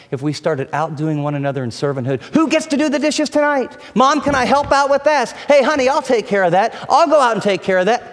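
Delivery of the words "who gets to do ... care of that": eager family helpers. Pretend. Imagined family members offering help.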